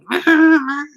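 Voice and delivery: loud, high held honk; high-pitched mimicked horn blast; imitated car horn. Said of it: "laying on the horn. I'm like".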